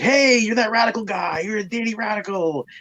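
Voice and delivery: high excited fan voice; a fan spotting him on the street; fans calling out. Retold or imitated. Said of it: "people were like".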